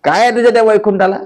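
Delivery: high, rapid repeated no; emphatic refusal. Acted. Namdev rejecting God's offer of Vaikuntha, high and fast. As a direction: brush the offer off.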